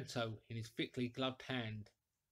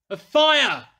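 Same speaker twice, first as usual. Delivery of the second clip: desperate shout, much higher and louder; crying out; 11 semitones higher, 19 dB louder than usual. A narrator's reading. Frozen stranger staggering into the inn, crying out. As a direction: bellow it out half-frozen, begging for warmth. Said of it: "he cried".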